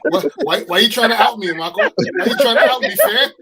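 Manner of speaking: laughing protest. Real, not acted. Man teased by friends for outing him, laughing protest. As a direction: laugh as you object to being teased.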